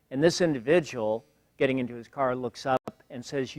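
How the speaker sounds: usual speaking voice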